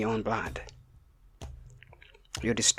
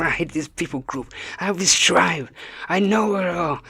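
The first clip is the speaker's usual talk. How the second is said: loud, high-pitched hateful rant; mock a hate-filled ranter, pitched up and shouting; venomous hatred; 5 semitones higher, 6 dB louder than usual. Imitating people who hate another tribe, venomous loud rant. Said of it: "venomous hatred, they're like".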